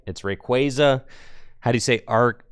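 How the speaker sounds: ordinary talk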